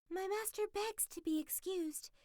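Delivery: high, polite fox voice; the fox bowing and apologizing for her master; fox making excuses. Acted. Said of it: "said to the king".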